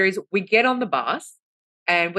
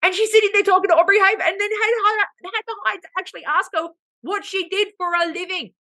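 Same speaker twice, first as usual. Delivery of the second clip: high-pitched exasperated disbelief; scandalised, high and emphatic; incredulous rant; 12 semitones higher than usual. Real, not acted. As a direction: rant upward in comic outrage. Incredulous rant about oblivious woman.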